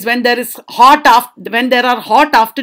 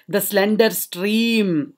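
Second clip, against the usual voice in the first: softer, drawn-out reading; slow flow; 7 dB quieter, slower than usual. Shown as a demonstration. Teacher pictures the stream for students, slow soft reading. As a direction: let the words trickle out slowly. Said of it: "It is so slow".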